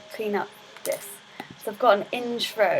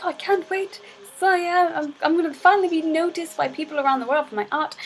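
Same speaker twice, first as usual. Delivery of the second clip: higher-pitched hopeful painter; eager game hero; 8 semitones higher than usual. Acted. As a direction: voice a hero full of anticipation. Voicing game hero's eager narration.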